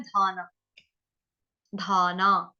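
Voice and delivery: aspirated dh in धाना, vowels held; long-vowel demo. Shown as a demonstration. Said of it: "ध के साथ क्या है?".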